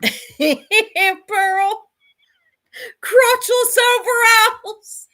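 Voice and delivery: high-pitched, loud, squealing laugh through the words; real laughter breaking up the words; cracking up. Real, not acted. Host reacting to a shocking clip, laughing high and loud.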